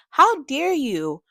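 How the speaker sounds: high, drawn-out outrage; indignant reproach. Acted. Reviewer confronting a grieving mother in the book, indignant. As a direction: stretch each word slowly, scandalized.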